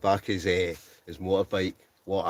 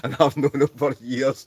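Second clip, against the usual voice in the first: amused; 5 semitones higher, 5 dB louder than usual. Real, not acted. Laughing at friend's teasing comment.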